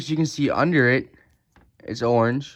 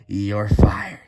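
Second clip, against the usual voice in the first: low raspy catchphrase growl; hammed-up boss firing someone in a low rasp; badly faked wrestling-boss voice, rough and low; Vince McMahon impression; 4 semitones lower than usual. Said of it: "Vince McMahon impression".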